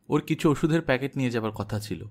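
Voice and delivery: ordinary delivery, nothing marked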